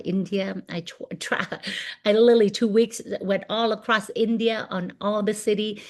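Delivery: ordinary talk, nothing marked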